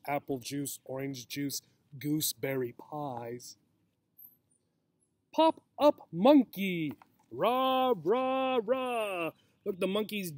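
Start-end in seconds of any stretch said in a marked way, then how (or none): 0.1-3.6 softer mock-repeat of the rhyme; quiet aside. Teacher quietly repeating the doctor's silly advice to children. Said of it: "The doctor sounds kind of silly". 5.3-9.3 a light cheer, rah rah rah. A narrator's reading. Reading the monkey's wake-up cheer to children, lightly cheering.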